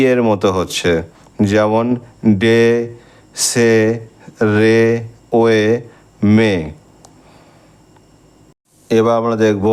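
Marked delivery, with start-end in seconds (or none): none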